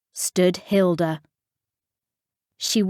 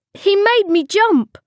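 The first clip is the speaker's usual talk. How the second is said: high, louder tearful protest; sobbing; 12 semitones higher, 5 dB louder than usual. A narrator's reading. Captain excusing himself for the broken cup, sobbing. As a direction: protest in a high, acted sob. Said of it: "sobbed Steve".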